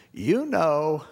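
sly bird getting the last word; parrot voice. Retold or imitated. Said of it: "and the parrot says".

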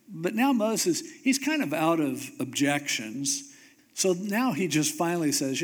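usual speaking voice

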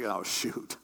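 low, soft groan of disappointment; dismayed King Saul. Acted. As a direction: drop it low under the breath.

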